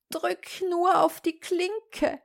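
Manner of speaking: high, slow call from a sickbed; frail granny calling out, high-pitched; weak old grandmother. Acted. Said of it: "rief die Großmutter".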